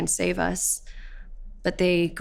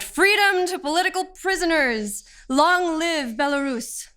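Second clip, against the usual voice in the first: high, ringing slogan chant; retold protest shout; 9 semitones higher than usual. Detainee quotes the slogans she allegedly shouted, high protest shout. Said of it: "I shouted".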